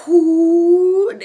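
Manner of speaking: long, loud rising "whoo"; high sustained hoot for something rushing down; imitated whoosh. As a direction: make a big swooping whoosh. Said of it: "That's the sound I'm thinking it'll make".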